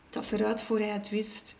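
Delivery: plain reading voice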